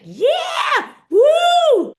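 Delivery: high, loud drawn-out whoop; whooping cheer. Retold or imitated. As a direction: cheer like a rowdy party guy. Retelling Cole's drunken cheering.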